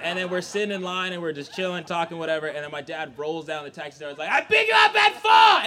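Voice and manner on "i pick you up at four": loud high-pitched holler; screaming dad. Acted. Dad hollering to his kid from the taxi, screaming. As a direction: yell it like an impatient driver. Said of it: "Why is your driver screaming at you".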